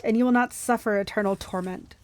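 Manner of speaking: plain reading voice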